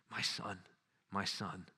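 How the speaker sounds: softly and tenderly, the grieving lion; a quiet, loving father's voice; tender, soft Aslan. A narrator's reading. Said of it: "said Aslan".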